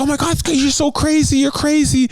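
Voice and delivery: high-pitched mock scream without much volume; hammed-up high, frantic voice; retold screaming. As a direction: imitate someone shrieking accusations. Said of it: "come in screaming".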